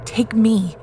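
choked out. Acted. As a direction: choke the words out in desperation. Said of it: "Jake choked out".

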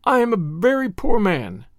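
high, wailing self-pity, lightly done; mild sobbing. A narrator's reading. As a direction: lament miserably without real tears. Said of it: "he sobbed".

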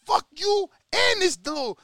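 hammed-up street yell at the traitor; Rocky yelling. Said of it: "Rocky's like".

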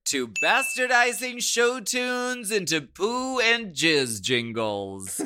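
high, loud announcer voice; hammed-up network announcement; mock promo announcer. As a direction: plug the show like an over-the-top promo. Mock promo for imagined show.

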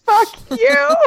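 high, loud mock snap; mock angry. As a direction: fire it at him, loud and high. Imagined retort to husband.

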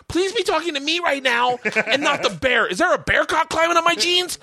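high, laughing panic; high panicked plea. Retold or imitated. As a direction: beg in a squeak. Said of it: "I'm like".

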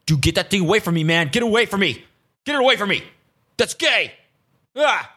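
imitating a freaked-out guy; mock insecure bro. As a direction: push the nail away in mock panic. Mocking insecure dudes.

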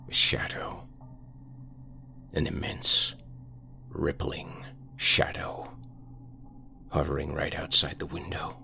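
breathy whisper, barely voiced; hushed whisper. Carl whispers what he sees, hushed whisper. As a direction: whisper it as if the thing might hear.

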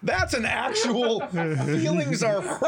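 teasing the co-host through laughter; laughing tease. Real, not acted. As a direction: laugh while teasing him.

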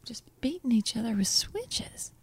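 hushed scandalized whisper. Acted. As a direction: whisper it like juicy gossip. Gossiping about kids' switches.

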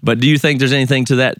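ordinary talk

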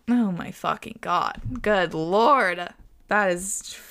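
usual speaking voice

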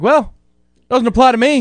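cocky. Retold or imitated. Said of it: "I'm like".

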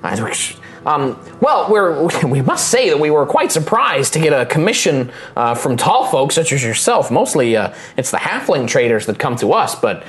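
high, friendly, chatty gnome voice; old gnome shipwright. Acted. Gnome couple telling the client about their trade, high and friendly. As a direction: chatter on happily about the commission.

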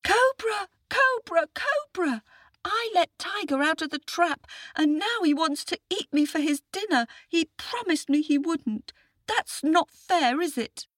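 put-on very high child voice; aggrieved little boy. A narrator's reading. Boy complains to a would-be rescuer, high aggrieved voice. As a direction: plead squeakily as a small boy.

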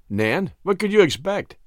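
plain reading voice

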